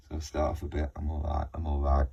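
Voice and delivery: mild mock gloom; low mood. Shown as a demonstration. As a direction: act out the bottom of the cycle quietly. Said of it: "Trim back a bit to get the impact".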